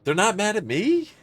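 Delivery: can't believe it, voice jumps up; genuinely surprised. Real, not acted. Surprised enemies aren't angry.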